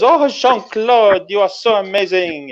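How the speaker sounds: high-pitched French admirer, drawn-out name; swooning Frenchwoman. Acted. Woman to the fighter pilot on his car, swooning. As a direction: coo it high in a French accent. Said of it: "And she goes".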